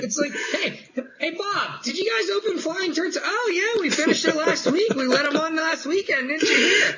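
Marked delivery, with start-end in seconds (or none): 0.5-3.2 casual worker call. Retold or imitated. Imitated worker asking a coworker. 3.2-6.9 high, bright, laughing mock answer; mock-cheerful, high and a bit louder; chirpy mock reply. Imitated coworker answers cheerfully.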